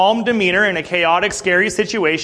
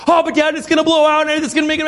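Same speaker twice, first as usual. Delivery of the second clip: put-on worried child; 8 semitones higher, faster than usual. Anxious son pleading.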